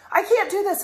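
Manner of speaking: put-on high, loud whiny kid voice; boy stuck in his shirt calling mommy, high whine; upset toddler. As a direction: whine like a frustrated three-year-old. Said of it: "he'll get so upset and be like".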